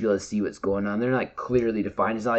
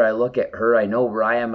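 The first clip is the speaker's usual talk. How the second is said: ordinary delivery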